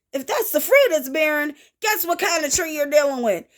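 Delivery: ordinary talk, nothing marked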